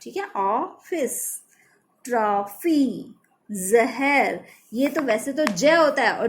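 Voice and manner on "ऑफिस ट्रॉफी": slow, careful ऑफिस and ट्रॉफी with open rounded ऑ; rounded aw sound demo. Shown as a demonstration. Teacher drawing out ऑफिस and ट्रॉफी for students. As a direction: stretch out ऑफिस and ट्रॉफी, opening the ऑ wide. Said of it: "ओ की आएगी".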